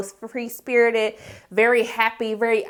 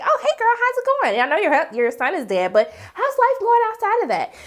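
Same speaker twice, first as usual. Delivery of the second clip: mock-perky high greeting; chipper; 9 semitones higher than usual. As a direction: imitate an over-cheerful friend making small talk. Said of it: "why you being all chipper".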